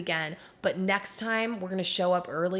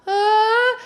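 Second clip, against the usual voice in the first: imitated stunned ah; 9 dB louder than usual. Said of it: "He's all".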